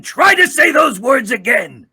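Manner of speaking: high, furious villain shout. Acted. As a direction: scream the challenge. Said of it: "to get Obito".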